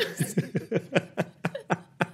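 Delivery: stifled breathy laugh; silent laugh. Real, not acted. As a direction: laugh without making a sound. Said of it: "Are you, are you laughing silently".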